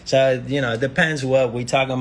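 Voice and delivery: high, loud put-on French accent; mock French accent. Man urging a friend to enjoy life, mock French accent. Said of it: "I don't know why I'm doing a French accent".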